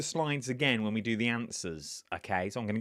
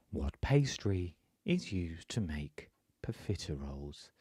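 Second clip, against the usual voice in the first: low, measured cookery-show voice-over; deliberate, dramatic quiz question; MasterChef man voice; 6 semitones lower, 3 dB quieter than usual. Real, not acted. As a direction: read it like the MasterChef man, with weighty pauses. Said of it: "I sound like the man on MasterChef".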